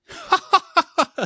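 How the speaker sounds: high cackling mock laugh. A narrator's reading. Petey laughing at the hot dog, high cackle. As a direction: cackle in his face. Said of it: "says Petey".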